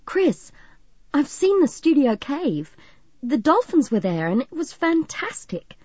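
high, eager outburst; bursting to share big news; thrilled, pitch leaping up; excited. A narrator's reading. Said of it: "then went on excitedly".

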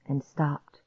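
ordinary delivery

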